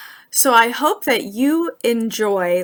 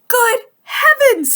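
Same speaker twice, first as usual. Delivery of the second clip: high, astonished exclamation; surprised; 6 dB louder than usual. Acted. Frog recognises Toad, high astonished exclamation. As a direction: gasp it out in amazement. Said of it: "said Frog".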